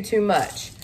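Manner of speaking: normal talking voice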